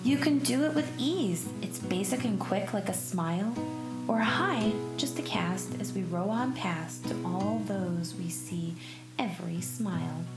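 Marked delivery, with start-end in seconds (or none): none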